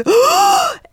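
loud, very high mock gasp; child's shocked gasp. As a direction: gasp like a kid suddenly found out. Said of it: "And she goes".

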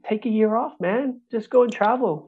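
imitated dad, high and airy; retold fatherly advice. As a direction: lift the pitch for dad's easy advice. Dad's easygoing advice, retold.

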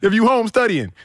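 high-pitched, comic exasperated rant; incredulous squawking about house rules; mock scolding. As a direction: scold in hammed-up disbelief, voice way up. Acted strict-household rant, listeners laughing.

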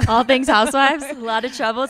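gleeful quoting back of her line; excited echo. Real, not acted. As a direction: savor it back to her with delight. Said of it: "I love that".

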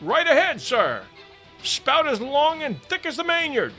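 high-pitched hail from aloft; lookout's shout. A narrator's reading. Lookout reporting the spout to the captain, high shout. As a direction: call the sighting down to the deck.

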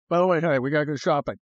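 usual speaking voice

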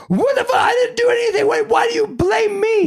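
high, shrill mock outburst of the wife; mimicking his wife. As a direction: squeak it out like the wife caught off guard. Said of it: "Rosalind comes running out and says".

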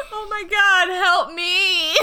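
high laughing plea. Real, not acted. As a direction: laugh through the words. Laughing fit after partner's line.

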